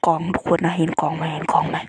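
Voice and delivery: shouting. Real, not acted. Shouting at viewers about box.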